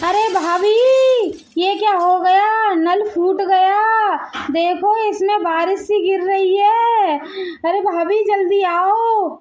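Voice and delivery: panicked calling out. Acted. Guest calling sister-in-law to a burst tap, panicked calling. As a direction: call for help in hammed-up panic. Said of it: "क्यों चीख रही हैं ये".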